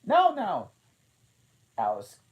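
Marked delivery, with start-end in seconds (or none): 0.0-0.7 high, loud protest; the Queen crying no; cried out. A narrator's reading. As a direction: cry it out sharply. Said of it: "the queen cried".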